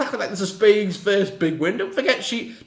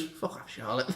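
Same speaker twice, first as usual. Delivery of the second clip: muttered insult; 7 semitones lower, 13 dB quieter than usual. Real, not acted.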